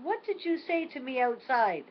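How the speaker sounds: mock a toddler's impatience, repeating himself; frustrated grandson. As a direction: grandson mildly frustrated, asking again. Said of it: "he's getting a little bit frustrated".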